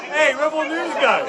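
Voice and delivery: high, loud jeering yell; angry shout. Real, not acted. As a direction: scream the insult across the crowd. Heckler yelling at politician.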